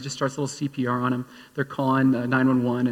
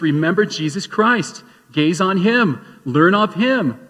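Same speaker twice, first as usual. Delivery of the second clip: slow, louder, weighted scripture reading; preaching scripture; 4 semitones higher, 5 dB louder, slower than usual. Acted. Pastor reciting Paul's letter to Timothy, slow and forceful. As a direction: lean on every word from the pulpit.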